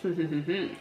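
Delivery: soft, self-satisfied closed-mouth laugh; quiet hm-hm-hm giggle; low chuckle. Real, not acted. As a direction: chuckle softly to yourself. Chuckles at own ass-kissing.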